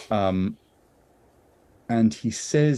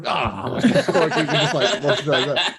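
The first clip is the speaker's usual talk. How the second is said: laughing reaction; 6 semitones higher than usual. Retold or imitated. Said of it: "we all just actually do and go".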